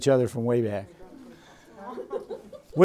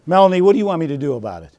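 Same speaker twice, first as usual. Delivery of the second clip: fast, louder snappy challenge; mean, accusatory; 6 semitones higher, 9 dB louder, faster than usual. Shown as a demonstration. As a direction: throw it back at her like an accusation. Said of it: "Doesn't that sound mean?".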